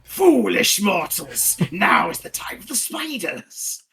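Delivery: slow, high, hammed-up villainess proclamation; scenery-chewing drow priestess. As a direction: overact it like an evil spider priestess. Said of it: "scenery-chewing drow priestess of Loth".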